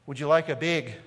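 imitated northern clerk's accent, bag said as "beg". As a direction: mimic a northern US accent on "big". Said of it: "completely different than how we say it here".

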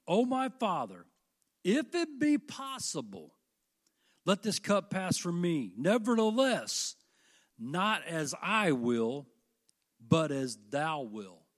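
slow and loud, falling at the end; solemn prayer reading. Acted. Preacher voicing Jesus praying in the garden, slow and solemn.

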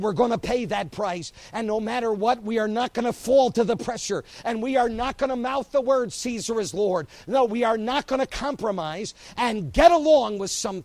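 loud, high, building vow; the little church vows to hold firm, loud and defiant; defiant preaching. Acted. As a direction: proclaim it with full force.

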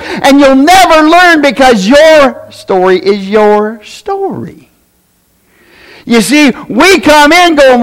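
high-pitched, emphatic sermon delivery; fired-up preaching. Real, not acted. Preacher telling the congregation God is still God in hard times, fired-up preaching. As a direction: preach it hard, stretching the big phrases.

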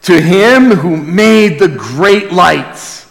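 ordinary delivery

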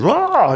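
high short startled cry; startled yelp. Acted. Moses flees the serpent.

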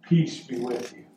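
low, soft reassurance; Jesus. Acted. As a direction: speak peace gently to one listener. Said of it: "he is saying to you".

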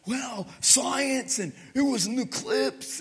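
high, mocking voice of a know-it-all; mocking skeptic. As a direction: mimic the doubters with scorn. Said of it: "All these people tried to".

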